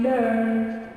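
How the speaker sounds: American "learn" with a held R; American accent. Shown as a demonstration. Teacher gives the American one for class, American accent. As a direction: sound the R fully in "learn", American style. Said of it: "the American one is".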